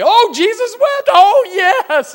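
high, loud gushing delight; mock amazed owner. Owner hearing the dog quote Scripture, gushing high and loud. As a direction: squeal it like a thrilled pet owner. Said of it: "and you say".

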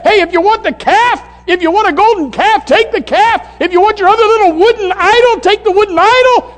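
loud, high-pitched preacher's dare; shouted challenge. Acted. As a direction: throw the challenge out at full force. Acts Joshua challenging idol worshippers.

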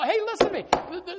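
normal talking voice

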